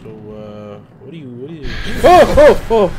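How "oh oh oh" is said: high, loud frightened scream; sudden loud shriek of fright; real scream. Real, not acted. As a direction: shriek at the jump scare. Sudden jump scare.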